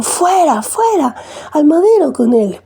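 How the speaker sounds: high-pitched read-aloud crowd cry; crowd shouting. A narrator's reading. The crowd yelling back at Pilate, high and urgent. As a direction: cry out to send him away, pitched high. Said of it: "Pero ellos gritaron".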